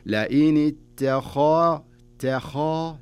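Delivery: the emphatic خ in اتخذت; heavy kha. Shown as a demonstration. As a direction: stress the full heavy خ in اتخا. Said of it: "هنا تفخيم".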